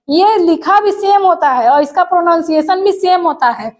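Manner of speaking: ordinary talk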